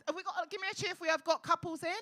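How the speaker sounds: loud call for a cheer; rally the room from the stage; compère's loud crowd call. Real, not acted. Calling out to the crowd.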